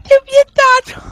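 high, loud cry of outrage; angry shout. Real, not acted. Player shouts at banned teammate.

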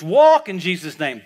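slow, loud command to rise; commanding shout. Retold or imitated. Wigglesworth commanding the dead woman to walk, a slow loud shout. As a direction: bark it out slowly like a healer commanding the dead. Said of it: "he goes like this".

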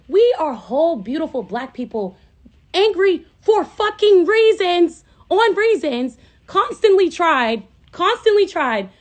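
loud, high furious rant; angry rant. Real, not acted. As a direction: let the real rage pour out. Ranting about being called angry.

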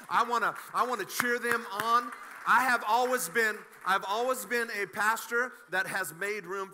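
loud, building preacher's declaration; full-force preaching. Acted. Pastor to church, rousing loud declaration about making room. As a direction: drive it home to the congregation.

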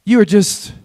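lively preacher cadence; preaching. Acted. Pastor preaching to his church, rousing. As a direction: preach it with rising energy to the congregation.

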